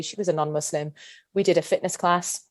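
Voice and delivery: ordinary delivery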